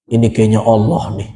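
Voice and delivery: low, hurried mock of a naive mother; mother's voice. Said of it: "Terus ibu bilang". (menirukan suara ibu, rendah dan cepat)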